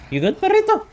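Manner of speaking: high cooing talk to a puppy; high pet voice. Pretend. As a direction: coo it like to a little dog. Cooing at companion.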